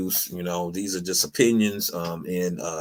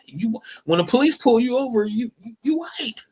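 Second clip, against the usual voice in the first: high emphatic punchline; 4 dB louder than usual. Retold or imitated. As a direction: push it up, loud then high. Punchline about police stops.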